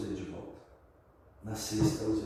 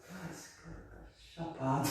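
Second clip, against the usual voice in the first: higher, softer inner remark; hushed, higher private thought; amused aside to self; 6 semitones higher, 8 dB quieter than usual. Retold or imitated. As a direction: murmur it to yourself in disbelief. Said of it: "olhava aquele cara e falava".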